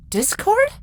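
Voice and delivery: high surprised question. A narrator's reading. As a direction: ask in disbelief. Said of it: "Avia said questioningly".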